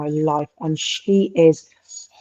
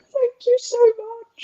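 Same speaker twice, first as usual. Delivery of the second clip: much higher, slow, breathless thank-you; grateful gush. Retold or imitated. Patient thanking the gentle nurse in a high, slow gush. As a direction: gush your thanks like you're melting with relief. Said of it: "And I was like".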